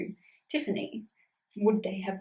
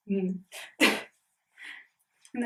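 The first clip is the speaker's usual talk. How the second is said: usual speaking voice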